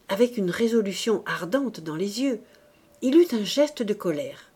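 plain reading voice

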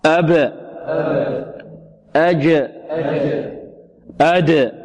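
ba, jim and dal of "أب، أج، أد" released with a short echo; qalqalah bounce. Shown as a demonstration. Teacher drills the qalqalah letters, echoing bounce on "أب، أج، أد". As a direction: bounce each final letter of "أب، أج، أد" without a full vowel. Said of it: "هكذا القلقلة لا تميل".

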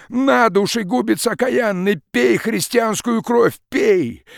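high, loud choking rage; furious outburst. Acted. Peasant hurling words at the forester, furious shout. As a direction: fling each word at him like a blow.

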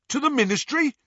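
high-pitched, eager question; questioning henchman. A narrator's reading. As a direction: pipe up to the gang leader. A Snatcher asks the leader.

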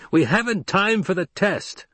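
high-pitched impatient objection; protesting. A narrator's reading. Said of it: "the voice above them protested".

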